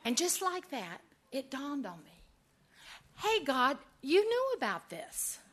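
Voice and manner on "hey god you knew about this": mock complaint to God. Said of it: "complaining to God".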